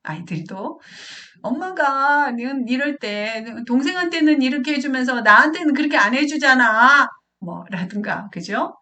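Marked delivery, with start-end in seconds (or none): 1.4-7.1 put-on louder, higher voice of an aggrieved child; child's complaint. Child protesting to mom about favouring a sibling, loud complaint. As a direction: act out a kid protesting loudly. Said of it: "이런 식으로 아이가 자기 마음을 말한단".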